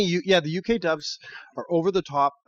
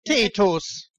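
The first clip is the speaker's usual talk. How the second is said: higher, hammed-up Irish; mock Irish accent; 4 semitones higher than usual. Co-host playing along with the Irish bit, mock Irish accent. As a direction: join in with a comic Irish accent. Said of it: "right, I'm Irish".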